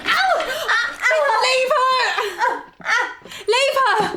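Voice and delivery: high pained yelps, then a desperate plea; high, drawn-out pained pleading; pained cries. Acted. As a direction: cry out in pain and beg him to stop. Sister being attacked, in pain.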